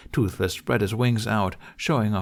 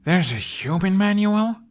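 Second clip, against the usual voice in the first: much higher, louder, stress on manual; amazed boy shouting up at dragon; surprised shout; 11 semitones higher, 6 dB louder than usual. A narrator's reading. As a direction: blurt it out, shocked and loud. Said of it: "unthinkingly shouted".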